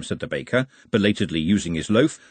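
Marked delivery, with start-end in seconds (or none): none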